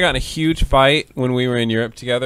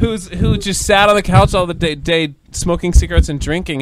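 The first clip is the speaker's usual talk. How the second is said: raised, loud indignant complaint; acted exasperation; 5 semitones higher, 4 dB louder than usual. Imitating herself complaining to friend about dad, exasperated. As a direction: keep the incredulous protest going. Protests friend's choice of revival.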